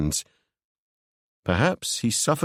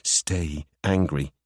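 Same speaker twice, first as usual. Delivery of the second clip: slow, low, dark line; low Ewan voice; 6 semitones lower, slower than usual. Acted. Ewan telling Seb to stay angry, low and slow.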